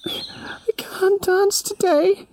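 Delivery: barely able to get the words out; whispered grief. Acted. Yami refusing the birds in a high whisper. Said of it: "Yami shook her head and said".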